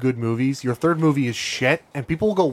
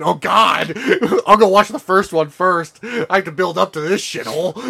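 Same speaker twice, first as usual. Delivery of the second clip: laughing; 4 semitones higher, 4 dB louder than usual. Retold or imitated. Retold reaction, laughing.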